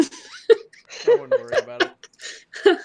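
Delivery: laughing. Real, not acted. Laughing through the line.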